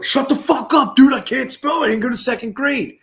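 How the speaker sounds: high-pitched, loud indignant shout; defensive yell. Retold or imitated. Bad speller answering the list reader from another room, defensive yell. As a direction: yell it through to the other room. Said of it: "I heard it in the other room".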